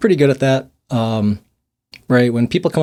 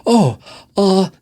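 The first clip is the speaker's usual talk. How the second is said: higher, deflated stammer of an angry driver; caught-off-guard voice; 9 semitones higher, 4 dB louder than usual. Retold or imitated. Angry driver suddenly disarmed, a higher stunned stammer. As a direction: imitate the driver losing their fight mid-word. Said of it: "but their voice was like".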